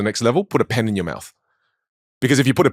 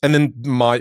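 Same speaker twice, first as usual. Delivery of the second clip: normal talking voice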